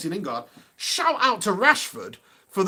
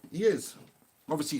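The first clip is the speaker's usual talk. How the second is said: usual speaking voice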